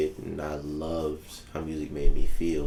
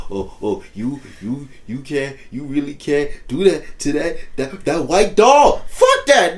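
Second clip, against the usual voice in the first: much higher and louder, building to a yell; shouting rant; 10 semitones higher, 12 dB louder than usual. Real, not acted. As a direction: shout it out, worked up about the doll.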